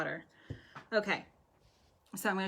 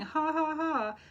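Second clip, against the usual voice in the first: flat forced ha ha ha; mock fake laughter, higher; fake laughing; 8 semitones higher, slower than usual. Shown as a demonstration. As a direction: demonstrate a hollow fake laugh. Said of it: "I'm not talking about fake laughing".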